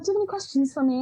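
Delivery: put-on British accent. British interviewer inviting questions, in an imitated British accent. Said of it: "she had this lovely British accent".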